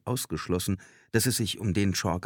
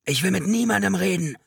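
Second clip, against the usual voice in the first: high, loud frantic yell; shrieked, high-pitched refusal; desperate scream; 14 semitones higher, 6 dB louder than usual. A narrator's reading. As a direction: scream your refusal at the police. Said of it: "ein kaum zu verstehender Schrei".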